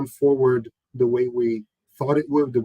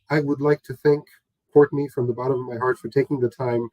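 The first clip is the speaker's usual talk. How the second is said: usual speaking voice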